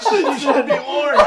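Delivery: high, slow, through laughter; laughing. Real, not acted. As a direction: giggle it out. Laughing while warning others.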